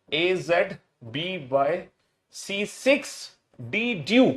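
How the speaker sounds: slow chanted letter drill, each pair rising; drawn-out letter-by-letter chant; recitation demo. Shown as a demonstration. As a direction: show the class how to recite, slowly. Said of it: "मैं आपको पढ़ के दिखाऊंगा".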